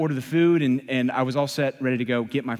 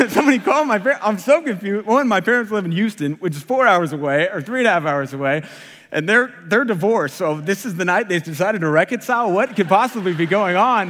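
high, louder, baffled rush of thoughts; acted bewilderment; 4 semitones higher, 4 dB louder than usual. Waiter puzzling over divorced parents' visit, baffled and agitated. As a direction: work it out aloud in comic disbelief.

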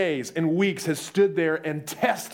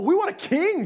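whiny demand; 13 semitones higher than usual. Retold or imitated. People demanding a king from God.

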